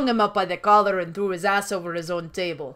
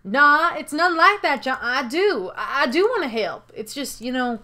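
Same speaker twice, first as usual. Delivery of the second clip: rustic partner protesting, then easing down; apologetic country character; sheepish John. Acted. Sheepish John excuses himself.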